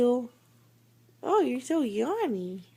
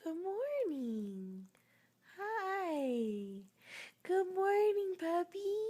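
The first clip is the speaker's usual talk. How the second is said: soft, high, slow puppy talk; 8 semitones higher, 11 dB quieter, slower than usual. Real, not acted. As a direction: coo at a sleepy puppy. Waking the dog, baby talk.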